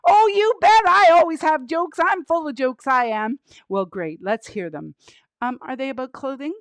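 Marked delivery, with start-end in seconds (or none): 0.1-3.4 raised, emphatic puppet voice; eager puppet joker. Pretend. Penny tells the host she has jokes, eager puppet voice. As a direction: boast eagerly as the puppet comedian.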